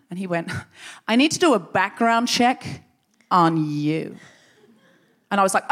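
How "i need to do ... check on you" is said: slow, deliberate mock menace; sinister threat. Elder threatening a check on her, slow sinister voice.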